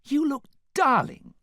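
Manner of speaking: very high, loud gush; squealed, over-sweet compliment. A narrator's reading. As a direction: gush over her outfit.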